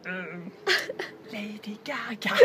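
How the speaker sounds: whispered, slow and unsure; timid whisper. Retold or imitated. Timidly whispering the answer to teammates. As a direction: barely breathe the answer.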